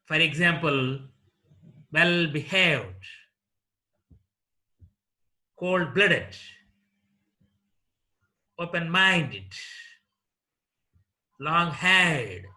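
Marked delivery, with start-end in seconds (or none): 1.9-12.4 "well-behaved", "cold-blooded", "open-minded", "long-haired" stressed on the second part. Shown as a demonstration. Teacher giving compound adjectives, second part stressed in "well-behaved", "cold-blooded", "open-minded", "long-haired". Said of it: "stress is laid on the second part".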